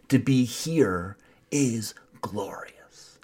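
slow, hushed, awed; reverent whisper. Acted. Preacher quoting a poet in a slow awed whisper. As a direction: breathe the poet's line slowly. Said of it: "as the poet said".

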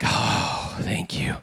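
breathy whispered thanks, relieved; relieved driver whispering thanks to the officer. Retold or imitated. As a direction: sigh out thanks under your breath. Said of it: "you're like".

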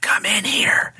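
insistent spooky whisper; eerie, forceful stage whisper; ghostly voice. Retold or imitated. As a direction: whisper it like an unseen thing luring someone. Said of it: "And it's like".

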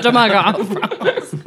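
mock French accent; lightly imitated French accent. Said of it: "really terrible French accent".

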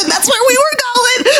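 high-pitched comic wail; high, mock-despairing exclamation; exasperated outburst. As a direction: cry out because the kid guessed right. Mom reacting to son's correct guess.

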